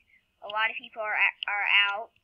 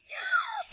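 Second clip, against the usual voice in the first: sharp, high intake of breath; startled gasp. Real, not acted. Player surprised by a chasing minion, gasping. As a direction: gasp in sudden alarm. Startled while being chased.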